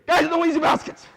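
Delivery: loud, high, quick yell; mock coach yelling instructions; sideline coach call. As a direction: holler it like a coach shouting from the chair. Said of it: "to sit here in my chair and say".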